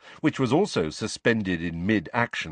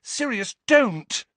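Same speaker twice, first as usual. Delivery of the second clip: high-pitched urgent plea, loud at the end; urgent shout; 14 semitones higher, 3 dB louder, slower than usual. A narrator's reading. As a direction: cry out to stop him. Harry pleading to stop a fight.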